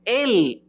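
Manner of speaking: Él pitched high and stressed; Él said high, like an exclamation; emphatic Él. Shown as a demonstration. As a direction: spike the word Él. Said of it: "es un énfasis".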